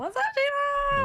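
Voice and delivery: high, drawn-out mock greeting; playful call-out. Joking on her dad's name, high playful holler. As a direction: sing it out long and high.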